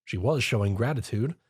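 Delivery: ordinary delivery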